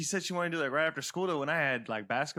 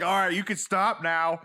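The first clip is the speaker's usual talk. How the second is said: mock exasperated order; 3 semitones higher, 6 dB louder, slower than usual. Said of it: "it's like".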